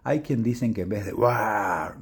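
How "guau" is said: friendly monster voice. Acted.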